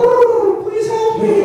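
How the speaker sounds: very high, loud squealed plea; mock terrified plea. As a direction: squeal for help, hammed-up. Scared teller pleading for rescue.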